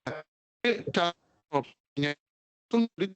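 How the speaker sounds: choppy breaking-up connection; glitching audio. Real, not acted. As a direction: voice cutting in and out. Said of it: "Putus suara".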